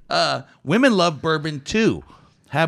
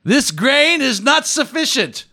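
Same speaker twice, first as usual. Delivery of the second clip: loud, high, slow proclamation; mock imperious decree; 12 semitones higher, 8 dB louder than usual. Host playing a grain inspector turning a delivery away, mock imperious. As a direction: grandly banish it in mock outrage.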